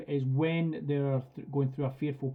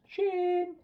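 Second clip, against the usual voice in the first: much higher, sing-song "Shane."; girly recall call. Shown as a demonstration. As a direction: call the dog in a high happy voice. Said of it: "make my voice sound as girly".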